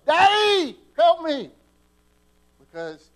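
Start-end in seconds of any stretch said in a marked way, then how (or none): 0.1-1.5 screamed high and very loud for Daddy; child swept downstream screaming for his dad; desperate yell. Pretend. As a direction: yell for help like a boy drowning in the creek. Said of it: "No, I yell".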